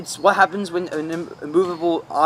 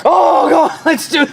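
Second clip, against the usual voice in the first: pumped-up shout; 9 semitones higher, 5 dB louder than usual. Retold or imitated. Retelling pumped-up Scarface self.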